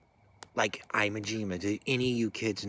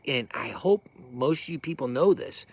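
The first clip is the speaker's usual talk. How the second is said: ordinary delivery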